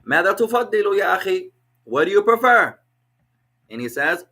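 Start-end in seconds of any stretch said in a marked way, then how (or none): 0.0-1.5 higher, louder, quick question; asking a friend. Shown as a demonstration. Teacher voicing a friendly question to a brother. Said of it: "you say to them". 1.8-2.8 higher and louder, called out; louder question. Shown as a demonstration. Teacher voicing the question to a student, loud. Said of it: "you say to them".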